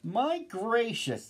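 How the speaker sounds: high, slow exclamations of wonder; astonished crowd, high-pitched and drawn out; amazed shouting. A narrator's reading. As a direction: draw out each cry in amazement. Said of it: "they shouted".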